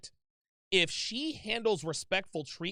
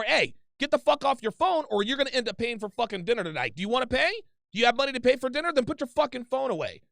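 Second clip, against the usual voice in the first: put-on loud, higher, angry order at a girlfriend; raised voice; 5 semitones higher, 5 dB louder, faster than usual. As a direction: bark it out like you mean it. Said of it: "you need to raise your fucking voice".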